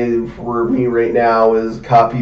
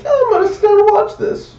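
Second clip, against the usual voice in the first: squeaky, much higher mock eagerness; mock excited. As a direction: play up the rare anime you'd gladly binge. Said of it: "There are few animes that I'm like".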